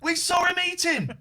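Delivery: high, loud gleeful shout; excited shout. Real, not acted. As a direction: call it out with delight. Catching guest eating on camera.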